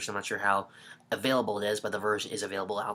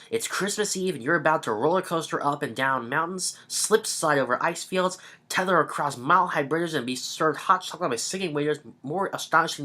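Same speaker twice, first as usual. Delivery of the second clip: lively, higher-pitched cover-copy read; promotional blurb read; 4 semitones higher than usual. Acted. Reviewer reading the DVD back cover to viewers, hyped promo read. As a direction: read it like an excited trailer.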